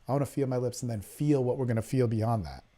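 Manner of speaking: a quiet, lightly seductive plea; soft coaxing. Shown as a demonstration. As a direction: coax her quietly, no push. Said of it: "and just say".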